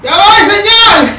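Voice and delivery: shouted reply. Acted. Answering a call.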